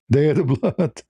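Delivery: ordinary talk, nothing marked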